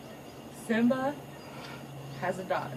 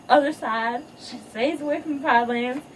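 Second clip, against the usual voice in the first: normal talking voice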